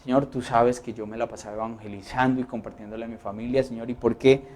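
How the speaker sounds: soft, low, hurt complaint to God; complaining prayer. Acted. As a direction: murmur the grievance quietly. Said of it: "Porque usted podría decir". (queja suave y dolida a Dios)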